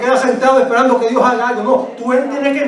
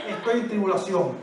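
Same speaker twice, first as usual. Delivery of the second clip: hushed answer; 2 semitones lower, 11 dB quieter than usual. Shown as a demonstration. Quiet falling answer.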